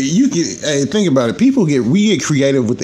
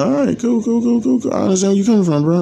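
much higher, eager cool cool cool; mock quick agreement; 11 semitones higher than usual. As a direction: cave in fast with a high sheepish yes. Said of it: "I was like".

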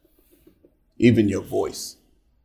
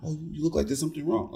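higher, hushed, imitated concern; concerned coworker; 4 semitones higher, 10 dB quieter, faster than usual. Coworker doubting that all is fine, high and hushed. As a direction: press softly as a worried colleague. Imitates worried colleague pressing further.

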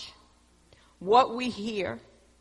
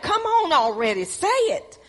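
high, loud, impatient demand to spit it out; anxious impatience, pitched way up and louder; impatient urging; 10 semitones higher, 4 dB louder than usual. Retold or imitated. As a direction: hurry them along, exasperated and much higher. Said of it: "You be like".

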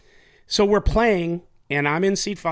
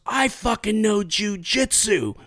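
higher, slow, puffed-up boast; mock tough guy; 8 semitones higher, 3 dB louder, slower than usual. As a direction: do him proudly boasting jujitsu, pitched up. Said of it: "Like that".

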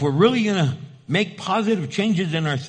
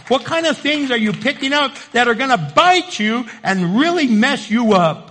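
raised, urgent pulpit voice; impassioned preacher, higher and punchy; forceful preaching; 6 semitones higher, 4 dB louder than usual. Real, not acted. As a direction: drive it home to the congregation.

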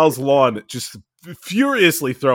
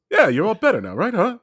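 higher, lightly voiced dad check-in; friend's dad impression; 6 semitones higher than usual. Dad checking on sick kid, light impression. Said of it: "and he goes".

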